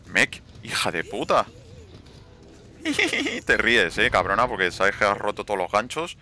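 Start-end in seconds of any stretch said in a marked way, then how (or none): 2.8-3.4 mocking laugh. Mocking teammate who broke hooks.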